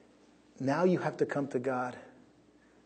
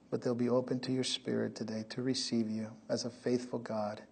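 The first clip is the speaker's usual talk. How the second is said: prayer; 4 semitones lower, 5 dB quieter than usual. Acted. Pastor leading the congregation in quiet prayer. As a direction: pray gently over the people.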